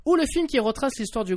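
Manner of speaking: lively podcast topic read; radio host intro. Acted. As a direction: announce the topic like a radio show. Reads the show's topic intro.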